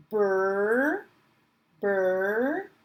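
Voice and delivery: "burr" as the drawn-out opening b-r sound of bride; teacher isolating "burr" for beginning readers. Shown as a demonstration. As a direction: stretch "burr" with a rising end, as a phonics sound. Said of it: "in bride".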